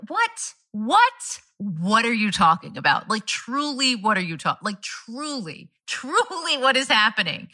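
high, incredulous what; baffled and half laughing; can't believe what you're hearing; stunned disbelief. Retold or imitated. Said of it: "that was just like".

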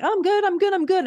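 high, airy "I'm good, I'm good"; sing-song brush-off pretending all is fine; fake chill. Host mimicking her old self hiding feelings, breezy.

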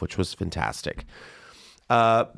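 normal talking voice

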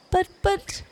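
very high, stammered protest; pleading. A narrator's reading. Wolf to the scornful fox, pleading. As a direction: squeak out a helpless plea. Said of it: "pleaded the wolf".

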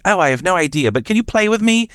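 put-on excited, high-pitched British child; bubbly little girl. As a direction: imitate a playful child asking for company. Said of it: "bubbly personality and very excited".

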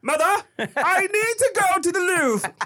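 put-on high, pompous British baby; British accent. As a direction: announce it in a hammed-up high British voice. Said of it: "busted out with this British accent".

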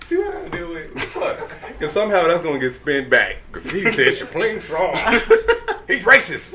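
tipsy and giggling; laughing through a tipsy ramble. Real, not acted. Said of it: "Yeah, you drunk".

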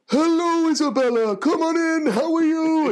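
put-on high, jolly Santa greeting a child; Father Christmas voice. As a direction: welcome a little visitor in a lofty, hammed-up Santa voice. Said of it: "imagine I was like".

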